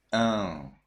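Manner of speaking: Un as a nasal hum through the nose; nasalized Un sound. Shown as a demonstration. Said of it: "you read them by your nose".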